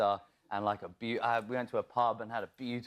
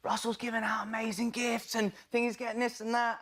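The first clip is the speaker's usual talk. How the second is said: high-pitched imitation of thrilled gossip; mock amazement, sustained high voice; excited gushing; 12 semitones higher than usual. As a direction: gush far above your usual pitch. Imitating excited cast receiving gifts.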